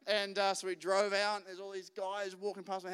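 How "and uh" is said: normal talking voice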